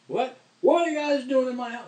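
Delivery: put-on high, loud, bewildered old man; confused old father. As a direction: shriek it like a lost old man. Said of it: "he's like".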